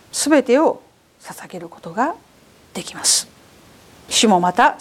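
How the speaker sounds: impassioned sermon delivery, big swings from booming to hushed; preaching at full force. Acted. Pastor urging the congregation to give everything, fervent preaching. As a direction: preach it, pulling back to a breathy close.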